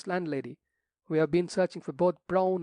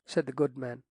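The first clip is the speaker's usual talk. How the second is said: ordinary delivery, nothing marked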